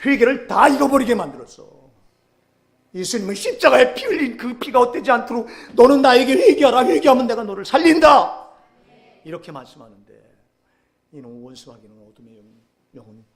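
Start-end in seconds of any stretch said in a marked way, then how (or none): 0.1-8.5 loud, high proclamation of God's call; fervent preaching shout. Acted. Preacher voicing God's call to repent, loud and high.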